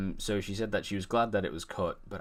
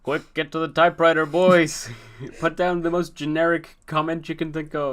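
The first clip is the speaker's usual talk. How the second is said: loud, higher-pitched mock order; barking boss; 8 semitones higher, 10 dB louder than usual. Boss ordering writers to rush out a script, loud hammed-up urgency. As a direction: call out like a boss rallying the troops.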